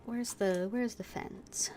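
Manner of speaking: normal talking voice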